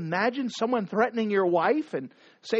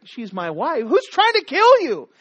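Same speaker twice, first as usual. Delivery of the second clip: higher, louder sudden outrage; outraged king; 4 semitones higher, 6 dB louder than usual. Acted. King outraged that someone threatens his wife, shouting. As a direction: demand who threatens her. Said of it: "the king's like".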